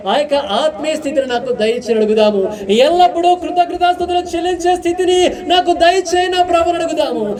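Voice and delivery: high, ringing prayer voice; impassioned pulpit prayer; fervent prayer. Acted. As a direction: cry out to God for the congregation.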